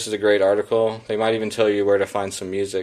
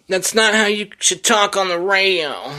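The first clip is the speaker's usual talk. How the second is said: high, put-on voice of a nagging critic; scolding critic voice; 11 semitones higher than usual. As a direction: scold him like a snooty radio critic. Imaginary critic scolds his delivery.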